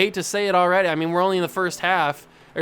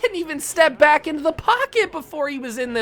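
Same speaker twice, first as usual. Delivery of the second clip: exasperated laughing plea; 8 semitones higher than usual. Real, not acted. Frustrated gamer pleading, laughing.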